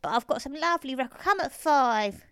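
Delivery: put-on high old man, slowing to a loud invitation; Mister Braithwaite. A narrator's reading. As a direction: invite as an elderly man, stretching the ending. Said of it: "Mister Braithwaite".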